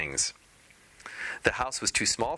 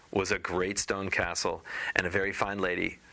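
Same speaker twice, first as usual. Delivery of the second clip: ordinary delivery, nothing marked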